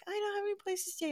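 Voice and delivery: high, soft, quick little voice; imitated girl's plea. Host quotes a girl needing somewhere to stay, small high voice.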